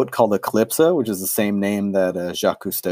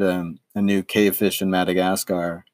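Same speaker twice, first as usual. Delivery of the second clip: normal talking voice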